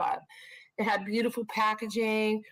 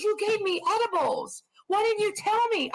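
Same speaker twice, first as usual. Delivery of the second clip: accusing friend; 10 semitones higher than usual. Retold or imitated. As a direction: squeak it out like a friend who was wronged. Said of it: "He goes".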